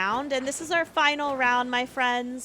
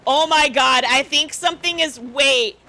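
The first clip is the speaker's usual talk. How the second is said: ordinary delivery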